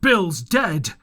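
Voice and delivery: loud shouted alarm; a pirate shouts the discovery from the house. A narrator's reading. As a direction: call out the news from inside. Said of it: "a voice shouting from the house".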